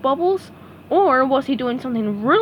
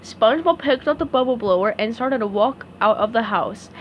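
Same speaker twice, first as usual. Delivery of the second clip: usual speaking voice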